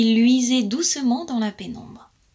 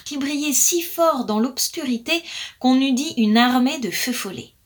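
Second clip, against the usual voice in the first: usual speaking voice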